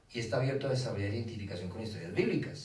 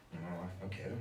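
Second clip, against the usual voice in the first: put-on child refusing; 3 semitones lower, 8 dB quieter than usual. Niño se niega.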